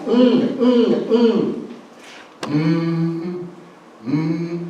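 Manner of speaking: soft hummed mm; humming mm. Shown as a demonstration. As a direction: hum it gently through closed lips. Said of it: "very important sound".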